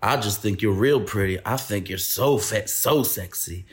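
low, slow Southern twang, laying on the flirtation; exaggerated Southern twang. Drunk storyteller imitating a rapper hitting on her, exaggerated Southern accent. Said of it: "I'm gonna try to do the accent".

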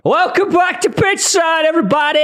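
show host. Acted.

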